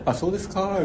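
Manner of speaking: high, hushed polite reply; mock meek acceptance. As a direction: play someone swallowing a complaint quietly. Echoes the imagined meek reply. (小声で素直に引き下がる真似)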